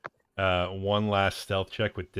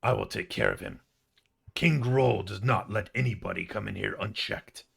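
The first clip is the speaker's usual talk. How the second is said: bugbear voice; 2 semitones higher, 5 dB louder than usual. Acted. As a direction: puff up and promise to handle him. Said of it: "To which the bugbear says".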